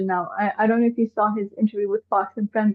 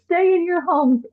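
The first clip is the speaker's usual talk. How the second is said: mimicking him, pitched up; a pitched-up, mocking order; 8 semitones higher than usual. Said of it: "he keeps on telling people".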